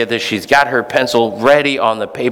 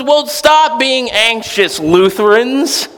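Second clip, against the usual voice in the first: slow, higher stop being anxious; Jesus commanding; 10 semitones higher, slower than usual. Retold or imitated. Jesus telling anxious Lutherans, slowly commanding. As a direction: give the order slowly and plainly. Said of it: "Jesus goes".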